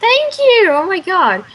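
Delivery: high, loud gush of gratitude; delighted thanks. Real, not acted. Friend answers praise of their accent, delighted gushing. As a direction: squeal your thanks at a lovely compliment.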